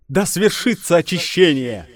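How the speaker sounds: loud, slow booming proclamation; thundering. Acted. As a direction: thunder after the fleeing crowd. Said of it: "гремело им вслед". (громогласно, гремящим голосом)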